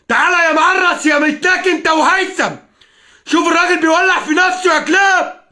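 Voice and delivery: screaming insults at the men he blames, out of control; furious shout. Real, not acted. Enraged vlogger yelling at online critics, furious shouting. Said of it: "انا منفعل يا جماعه".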